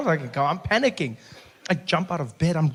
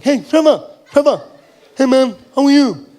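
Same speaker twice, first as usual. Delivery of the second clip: higher, louder voice of a partially deaf athlete; partially deaf fan; 7 semitones higher, 7 dB louder than usual. Acted. Deaf athlete greets the comedian after the show, high and loud. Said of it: "he was partially deaf".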